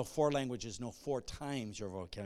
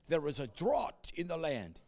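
put-on African accent; 2 semitones higher than usual. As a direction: quote him in an African accent. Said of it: "African accent".

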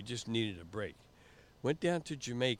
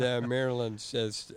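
ordinary talk, nothing marked

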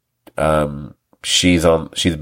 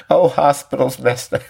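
higher mocking voice with a laugh; laughing mock excuse; 8 semitones higher than usual. Host mocks the character's lame lie, laughing as he parrots it. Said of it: "You know".

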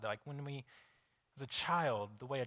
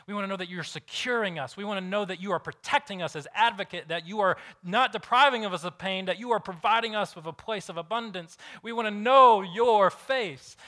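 rising, louder, urgent preacher's build; preaching; 6 semitones higher, 9 dB louder than usual. Acted. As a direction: preach it harder and harder. Preaching at full force.

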